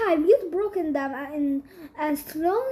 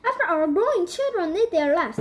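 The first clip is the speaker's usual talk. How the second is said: usual speaking voice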